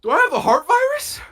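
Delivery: hammed-up fright at his own heartbeat; mock panic. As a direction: squeal it like sudden terror. Retelling sudden panic.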